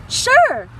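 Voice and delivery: high, loud, bright yes; eager. Acted. Dexter happily agreeing to sing Elvis in line. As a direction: jump at the chance.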